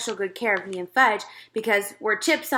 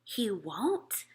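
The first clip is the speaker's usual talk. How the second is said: soft unvoiced whisper; 8 dB quieter than usual. A narrator's reading. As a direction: whisper it back like a secret. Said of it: "I whispered back".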